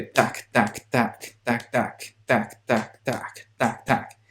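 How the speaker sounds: percussive tac-tac rhythm; imitated Caribbean beat. Shown as a demonstration. Reactor shows why it sounds Caribbean, imitated beat. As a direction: beat out the percussion in short syllables. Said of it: "It just sounds, uh, very Caribbean".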